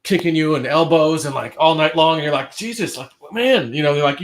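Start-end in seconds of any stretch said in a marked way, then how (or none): 2.6-3.7 mock exasperation. Voicing parent crowded in bed.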